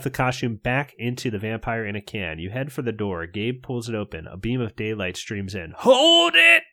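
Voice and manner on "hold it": much higher, louder scream. Acted. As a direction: scream it in panic. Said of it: "You scream".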